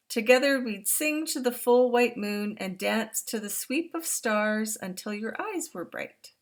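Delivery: put-on storybook reading to young listeners; reading to children. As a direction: read it out big and warm for the class. Reads picture book aloud.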